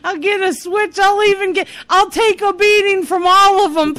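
high, loud mock begging; retold frantic pleading. Daughter holding the door begs mother for a beating, frantic pleading. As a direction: beg desperately, hammed-up and high. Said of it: "holding the door going".

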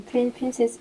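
ordinary delivery

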